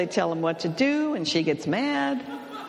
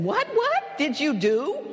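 retold startled alarm; 8 semitones higher than usual. Husband startled, drops everything.

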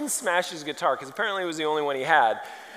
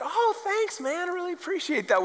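much higher, soft and gentle thanks; sweet nice guy; 12 semitones higher, 4 dB quieter than usual. Retold or imitated. Guitarist thanking the pastor after the set, sweet and soft.

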